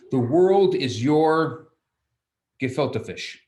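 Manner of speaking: slow, loud build to "gefilte fish"; comic punchline. Acted. Lecturer quoting Netzach as a joke, slow and loud.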